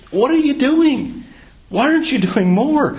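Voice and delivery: high, pained exasperation at the king; exasperated rebuke. Retold or imitated. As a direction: scold him in disbelief. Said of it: "And he says".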